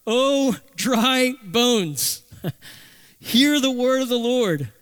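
drawn-out prophetic declaration; mock prophet proclaiming. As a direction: call out over the valley like a prophet. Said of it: "Say to the bones".

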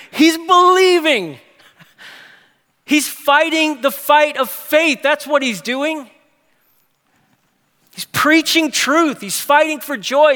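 preacher building to a fervent climax; preaching at full force. Real, not acted. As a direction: proclaim it like a rallying cry.

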